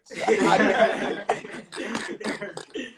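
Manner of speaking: drawn-out chuckle at own joke; hearty laugh. Real, not acted. Interviewer telling an Irish accent joke, hearty laugh. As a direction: laugh along at your own punchline.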